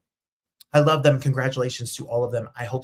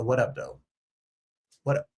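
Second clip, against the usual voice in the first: chill. Shown as a demonstration. Host demos the right way, relaxed and chill. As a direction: lean back and nod it out. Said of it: "It's chill. Lean back".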